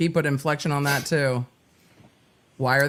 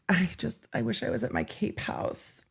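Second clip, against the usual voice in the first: mock whisper; 3 semitones higher, 8 dB quieter than usual. Mocking his text in whisper.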